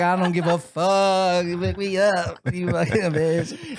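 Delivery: slow put-on diva voice; snooty diva ordering a ride; demanding diva. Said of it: "You sound like a diva".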